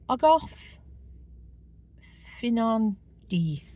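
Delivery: "Ogof Finandi" sounded out hesitantly, with a slow "Finandi"; having a careful go at "Ogof Finandi"; trying a Welsh name. Shown as a demonstration. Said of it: "I'm not gonna try and say it".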